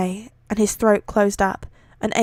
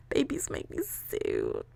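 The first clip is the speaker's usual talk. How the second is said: soft, breathy, tearful whisper about babies; emotional whisper; 4 semitones higher, 12 dB quieter than usual. Real, not acted. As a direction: hush it as if choked up. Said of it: "bloody cry about babies".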